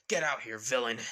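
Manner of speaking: loud, forceful demand; acted confrontational shout; shouted challenge. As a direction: challenge a hidden foe at full voice. Challenging someone in the shadows.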